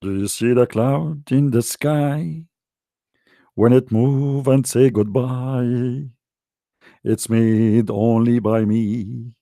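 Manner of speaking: slow, low, grandiose drawl; mock God voice. Mocking claimed miracles on stream, slow pompous god voice. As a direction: play a vain god taking credit for clouds. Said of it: "I can say now, like, I".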